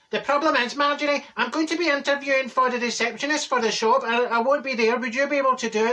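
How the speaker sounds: lightly voiced young man asking a favour; hairdresser Josh. Hairdresser asking Marjorie to run interviews, lightly voiced. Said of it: "he says".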